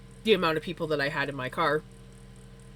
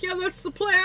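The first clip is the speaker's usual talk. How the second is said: high-pitched, nervous mock agreement; retold freak-out; 11 semitones higher, 3 dB louder than usual. Driver freaking out agrees with the cop, mock nervous. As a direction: agree in a squeaky mock panic, breaking into laughter. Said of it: "freaking out".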